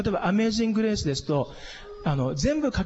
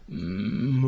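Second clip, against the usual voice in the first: vocal fry; 10 semitones lower, much slower than usual. Shown as a demonstration. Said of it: "これをどこで聞いたかというと".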